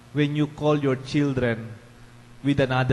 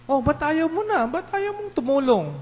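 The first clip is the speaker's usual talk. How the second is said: high-pitched acted pleading reproach; acted parental dismay, pitched high; exasperated parent; 13 semitones higher than usual. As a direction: question your child in a raised pitch. Acted parent questioning a child.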